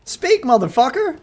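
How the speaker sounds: high goading taunt. Real, not acted. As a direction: goad him to speak up.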